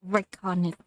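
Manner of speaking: normal talking voice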